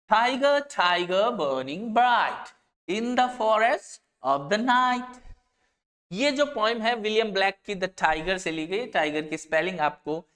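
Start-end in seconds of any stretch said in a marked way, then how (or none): 0.1-5.2 slow recital of a poem line; poem recitation. Shown as a demonstration. Teacher reads the example poem to the class as a slow recital. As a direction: recite the verse with weight. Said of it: "example वन कहता है".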